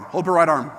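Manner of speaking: normal talking voice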